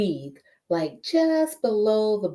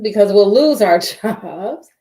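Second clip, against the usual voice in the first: louder, breaking into a laugh; amused, laughing emphasis; 2 semitones higher, 9 dB louder than usual. Real, not acted. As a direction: laugh through the last words. Laughs while stating the consequence.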